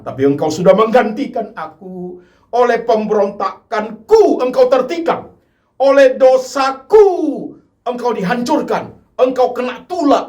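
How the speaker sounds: high, loud, pleading lament; anguished outcry. Acted. A sinner confesses before the cross in a loud, pleading lament. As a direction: cry it out, broken by your sins.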